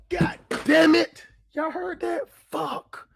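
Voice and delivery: sudden shocked curse, high-pitched; startled outburst. Real, not acted. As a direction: blurt it out in shock. Yapper dropped, startled streamer.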